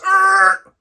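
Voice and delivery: loud, high screech of brakes; imitated brake screech. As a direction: mimic a car slamming to a stop.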